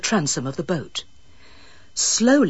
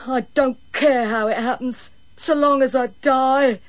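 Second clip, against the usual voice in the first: petulant; 6 semitones higher than usual. A narrator's reading. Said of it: "came the petulant reply".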